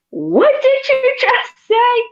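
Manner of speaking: imitating stunned disbelief, very high pitched; mock incredulous outburst. Salesman reacting to new hire's silly answer, mock incredulous. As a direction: squeal the question slowly, word by word.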